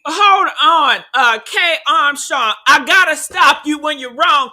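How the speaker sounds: loud, emphatic interruption; loud objection. Real, not acted. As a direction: cut in loudly to correct someone. Stops the video to object.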